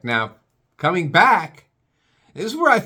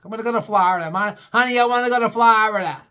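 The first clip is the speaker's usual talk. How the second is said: higher, louder mock New York accent; 9 semitones higher, 6 dB louder than usual. New Yorker whining about Florida, mock New York accent. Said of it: "That's how they talk in New York".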